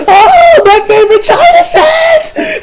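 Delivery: high wailing shriek, loud and drawn out; mother's anguished screech. Retold or imitated. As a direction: howl over the broken china set, slow. Mother shrieking over broken cup.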